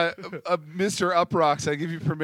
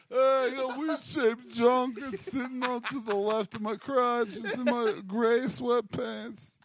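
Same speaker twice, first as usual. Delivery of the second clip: higher, slow dopey put-on voice; alter ego voice; 8 semitones higher, slower than usual. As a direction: drone it like a clueless dude. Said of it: "I've been consistent in my".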